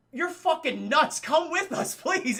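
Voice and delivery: high, loud mock pleading; hammed-up incredulous begging; exasperated plea. Exasperated plea in retold film.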